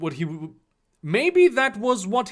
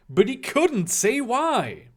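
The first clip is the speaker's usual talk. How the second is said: ordinary delivery, nothing marked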